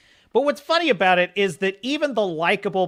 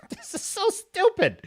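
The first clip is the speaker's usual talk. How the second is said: laughing. Real, not acted. Laughs at the stupid scene.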